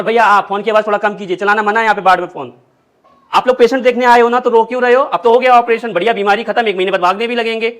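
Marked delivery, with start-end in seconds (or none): 3.3-5.9 loud scolding. Acted. Nurse scolding crying relatives.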